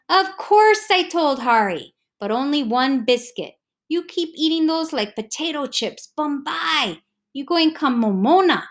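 lightly voiced Hawaiian uncle, mock scolding; a hint of a fussing local uncle; Uncle Kahana voice. A narrator's reading.

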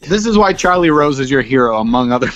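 normal talking voice

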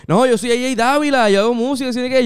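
high, eager self-introduction. Retold or imitated. Musician introduces himself to a new acquaintance, high and eager. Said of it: "como un mexicano que estaba como que imitando el acento puertorriqueño".

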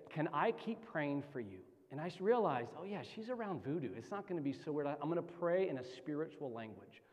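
hushed retelling; low-key retold asking. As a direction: say it low, as if to yourself. Retelling his quiet private prayer offer.

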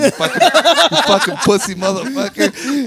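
mock insult. Mock-insulting his absent cousin.

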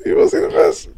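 high, airy mock whisper; playful whisper. Teasing a friend about the fish, playful whisper. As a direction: whisper it as a silly tease. Playful repeats in odd voices.

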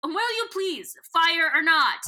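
impatient demand. Retold or imitated. Voicing Gareth pressing Visser One to shoot, mock impatient. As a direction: snap it out impatiently. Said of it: "Gareth is like".

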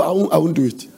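normal talking voice

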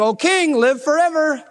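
high, odd voice from the den; a cheerful voice calling up; Daniel's voice. Acted.

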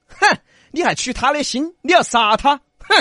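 high, loud challenge; indignant retort. A narrator's reading. Little ghost challenges the killer, indignant. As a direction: snort and challenge him.

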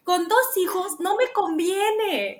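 a hammed-up panicked wife; mock panic. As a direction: act out spiralling worry, half joking. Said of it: "le dije".

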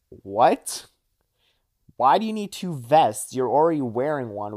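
incredulous questioning. Retold or imitated. Confronting Liam over his two vests, incredulous. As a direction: act out baffled disbelief after a long pause. Acted disbelief at Liam's excuse.

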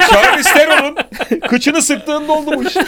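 high, loud, breaking into laughter; laughing. Real, not acted. Cracking up at his own joke.

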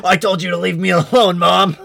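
loud, whiny yell back at mom; imitating Kiefer yelling at his mom; mock teen yell. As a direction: shout it like a sulky teenager. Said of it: "He's like".

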